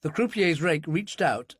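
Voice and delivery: ordinary delivery, nothing marked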